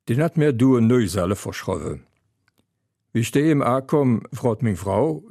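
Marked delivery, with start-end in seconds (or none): none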